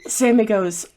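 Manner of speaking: normal talking voice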